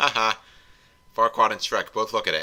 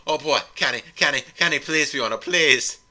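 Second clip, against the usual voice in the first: loud, high-pitched pleading; eager begging; 5 semitones higher, 6 dB louder than usual. Acted. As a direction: beg excitedly, louder with each please. Farquaad begs Fiona to stay.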